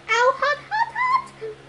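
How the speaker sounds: climbing squeaky yelps of pain. A narrator's reading. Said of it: "he yelled".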